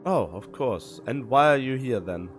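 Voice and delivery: normal talking voice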